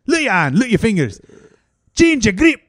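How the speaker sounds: mock coach yell. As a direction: drill the player like a bossy coach. Coach yelling.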